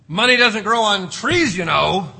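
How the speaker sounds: loud nagging parent voice; mock parent scolding. Parent lecturing a child about money, loud mock scold.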